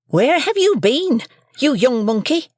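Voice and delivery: shrill high shrewish scold; scolding sister. A narrator's reading. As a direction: Mrs Joe scolds young Pip, high and shrill.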